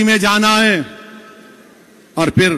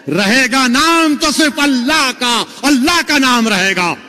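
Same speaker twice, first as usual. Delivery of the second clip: higher, ringing sermon climax; preaching at full force; 5 semitones higher than usual. Acted. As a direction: thunder out that only God remains. Climax about tyrants passing. (پرجوش خطابت کا عروج)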